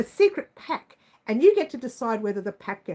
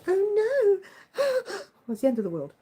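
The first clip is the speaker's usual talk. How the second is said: much higher hammed-up despair with a gasp; mock dramatic; 9 semitones higher than usual. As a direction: ham up the tragedy, then laugh. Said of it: "I wanna make it sound dramatic".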